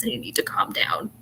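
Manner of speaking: a hushed imitating whisper of the partner; mock whisper. Imitating the partner on the phone, a mock whisper. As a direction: breathe it like a mock calm-down.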